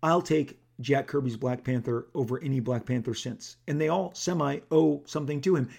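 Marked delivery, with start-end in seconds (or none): none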